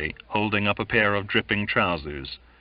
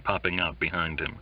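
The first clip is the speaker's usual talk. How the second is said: usual speaking voice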